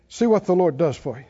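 usual speaking voice

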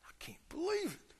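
high, breathless whispered disbelief; fuming whisper. Acted. Older son left outside the party, fuming in a whisper. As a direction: hiss it under your breath, outraged. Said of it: "He's mad. He's fuming".

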